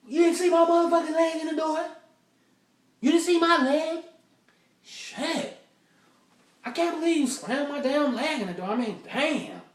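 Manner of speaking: high, loud furious rant; furious yelling. Retold or imitated. Date screaming at boyfriend about her leg, furious high yelling. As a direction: tear into him for slamming her leg. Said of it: "she talking and shit".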